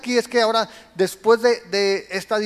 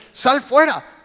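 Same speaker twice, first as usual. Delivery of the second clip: louder, higher sharp command; commanding shout; 6 semitones higher, 8 dB louder, slower than usual. Acted. Preacher voicing the order Jesus didn't give, commanding shout. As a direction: order it out loudly.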